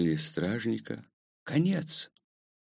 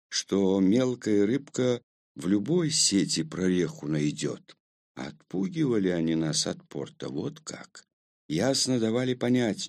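ordinary delivery, nothing marked

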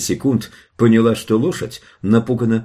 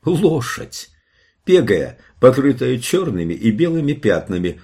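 higher-pitched amazed narration; astonished reveal; 5 semitones higher than usual. A narrator's reading. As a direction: reveal it in wonder. Narrator reveals the monster is a horse. (изумлённо)

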